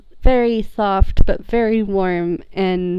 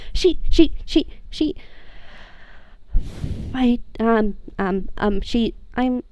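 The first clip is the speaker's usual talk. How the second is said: halting, stuttered, rising happy tears; stuttering teary excitement; stammering joy; 5 semitones higher than usual. Acted. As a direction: trip over words with emotion. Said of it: "and he says".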